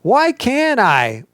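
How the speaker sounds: high, drawn-out whiny protest; stretched, indignant wail of objection; exclaimed why. Shown as a demonstration. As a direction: complain it as a statement, not a question. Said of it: "should end with an exclamation point".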